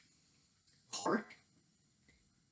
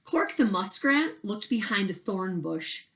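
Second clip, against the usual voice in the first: ordinary delivery, nothing marked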